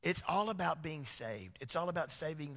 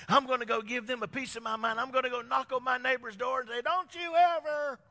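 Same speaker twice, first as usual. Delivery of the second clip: mock angry rant; 8 semitones higher, 7 dB louder than usual. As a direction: ham up a fuming resident storming off to confront someone. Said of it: "And so they're like".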